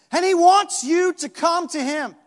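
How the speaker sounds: high, loud preaching at full force; fiery preaching. Acted. Preacher shouting to the congregation about Jesus' call. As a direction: drive it home to the room.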